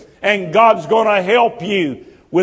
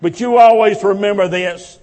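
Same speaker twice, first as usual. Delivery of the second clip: usual speaking voice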